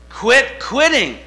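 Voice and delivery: "Quit quitting" shouted high and slow; "Quit quitting" belted out high, loud and slow; preached shout. Shown as a demonstration. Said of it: "I'm gonna change your life right now".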